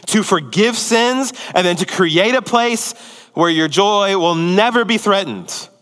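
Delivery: normal talking voice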